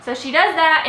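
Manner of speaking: usual speaking voice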